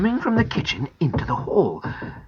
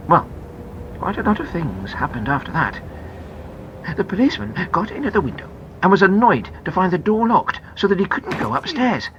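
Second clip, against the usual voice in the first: ordinary delivery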